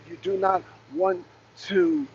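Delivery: usual speaking voice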